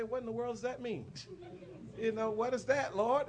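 high-pitched bewildered questioning, acted out; baffled. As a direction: ask it high and bewildered, playing up the confusion. Baffled reaction to neighbour's silent gesture.

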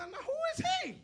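squeaky-high, loud incredulous cry; indignant challenge. Acted. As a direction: demand it in high-pitched disbelief. David challenges Goliath in story.

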